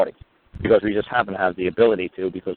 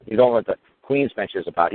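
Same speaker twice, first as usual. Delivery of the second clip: normal talking voice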